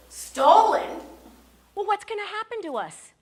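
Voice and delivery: very high, loud disbelief; shocked outcry. Retold or imitated. Ginger reacting to the stolen passport, loud shocked outcry. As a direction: gasp it out in shock.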